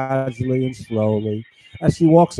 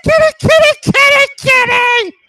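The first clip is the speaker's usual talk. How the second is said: mimicking call; 8 dB louder than usual. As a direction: call out like calling a cat, very high and stretched. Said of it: "they know how to say one thing".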